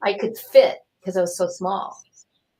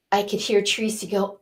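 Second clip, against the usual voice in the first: ordinary delivery, nothing marked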